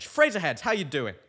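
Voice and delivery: loud, high host's greeting; big shout-out to the audience; calling out. Real, not acted. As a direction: hail the fans, starting high and loud. Host calls out to his fans.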